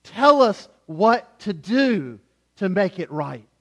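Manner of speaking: slow, loud earnest plea; the crowd asks Peter, earnestly pleading. Retold or imitated. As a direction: beg for direction. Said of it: "what do they say".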